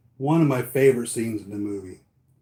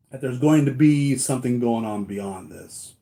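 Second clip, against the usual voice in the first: ordinary talk, nothing marked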